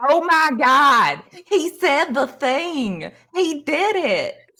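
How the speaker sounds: slow, high, hammed-up Southern drawl; excited fan in a badly faked Southern twang; horrible Southern accent. One fan imitates the idol's catchphrase, horrible Southern accent. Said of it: "puts on this very horrible Southern accent".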